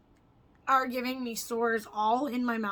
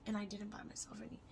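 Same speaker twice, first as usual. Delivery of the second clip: whisper; 10 dB quieter, faster than usual. Real, not acted. Confessing quietly.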